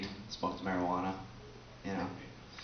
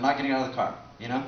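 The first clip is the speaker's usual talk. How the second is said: ordinary delivery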